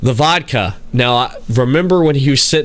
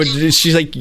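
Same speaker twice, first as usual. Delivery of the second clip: ordinary delivery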